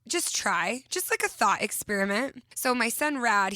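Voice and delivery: usual speaking voice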